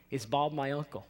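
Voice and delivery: lightly imitated strong Boston accent; puzzled landlord with a touch of Boston. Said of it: "with a strong, like, Boston accent".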